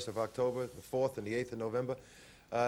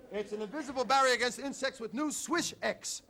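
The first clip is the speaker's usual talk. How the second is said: ad read; 9 semitones higher than usual. Acted. Hosts an ad pitch.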